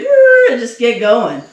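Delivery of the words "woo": high, loud whoop; excited whoop. Retold or imitated. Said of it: "we'd just be like".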